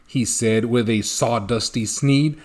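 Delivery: ordinary delivery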